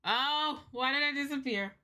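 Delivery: ordinary talk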